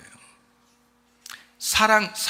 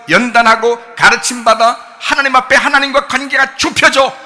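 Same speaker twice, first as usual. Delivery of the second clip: loud, raised-pitch exhortation; full-force preaching; 7 semitones higher, 13 dB louder than usual. Real, not acted. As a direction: proclaim it forcefully to the congregation. (큰 소리로 외치는 권면 설교)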